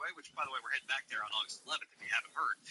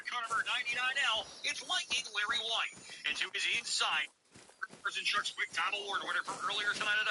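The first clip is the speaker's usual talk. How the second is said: race announcer intros; 5 semitones higher than usual. Acted. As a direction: hype each driver's name to the crowd. Announcer introducing the starting lineup.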